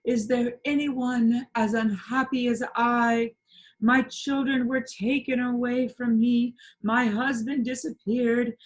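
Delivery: high, slow, melodramatic stage lament; plaintive lament. A narrator's reading. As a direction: wail it like a tragic heroine. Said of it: "said in a plaintive voice".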